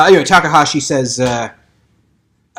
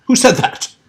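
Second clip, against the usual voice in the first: higher and louder, demanding; lightly voiced indignant old scholar; indignant old doctor; 10 semitones higher, 4 dB louder than usual. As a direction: snap round at the interrupter.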